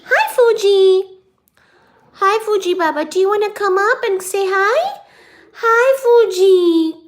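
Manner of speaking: high cooing pet voice. Real, not acted. Baby talk to the cat.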